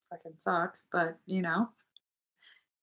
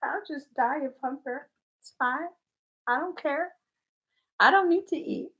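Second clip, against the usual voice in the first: mock martyr; 4 semitones higher, 7 dB quieter than usual. Real, not acted.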